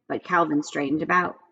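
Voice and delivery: ordinary delivery, nothing marked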